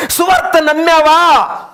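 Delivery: loud and slow, సువార్త hit hard; forceful challenge. Real, not acted. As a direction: thunder the question at them. Preacher challenges the congregation. (గట్టిగా నిలదీస్తూ)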